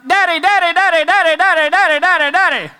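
high, loud, rapid-fire yapping; frantic, high-pitched doggy excitement; excited dog. Acted. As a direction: imitate an overjoyed dog greeting its owner. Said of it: "that dog's so excited to see me".

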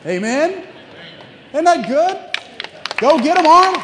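loud, high cheer to the congregation; rousing shout. Real, not acted. Preacher cheering on Arnold to the congregation, loud and high. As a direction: whip the crowd up, full voice.

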